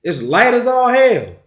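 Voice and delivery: high, fed-up complaint; a touch of mock frustration; exasperated. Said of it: "You like".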